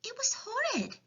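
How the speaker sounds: put-on small, high girl's voice; high, subdued orphan voice; Sophie. A narrator's reading. Sophie recalls punishment.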